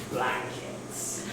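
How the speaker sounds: low, hushed, barely voiced; sinister reveal. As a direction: breathe it out as a devious secret. Revealing a devious plot.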